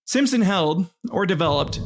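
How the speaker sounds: ordinary talk, nothing marked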